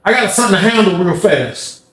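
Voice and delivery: high, urgent call; calling his homeboy. Retold or imitated. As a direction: ask a friend over in a hurry. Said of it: "He called his homeboy".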